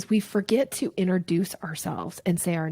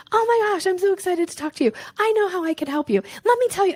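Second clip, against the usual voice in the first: overexcited word vomiting; 12 semitones higher, faster than usual. Retold or imitated. Coach mimics an overeager seller pouncing, high and fast. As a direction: gush all over them, way too eager. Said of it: "what I call dog calling energy".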